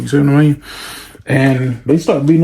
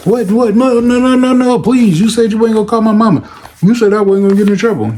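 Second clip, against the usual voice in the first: much higher, fast desperate begging; panicked pleading; 9 semitones higher, 4 dB louder than usual. Retold or imitated. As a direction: beg them not to call mom. Kid panicking at expulsion.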